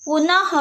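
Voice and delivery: पुनः said high and rising, ending in a half ह breath; visarga word. Shown as a demonstration. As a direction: announce पुनः with a lifted, breathy end. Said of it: "अह की ध्वनि आधे ह के उच्चारण की तरह".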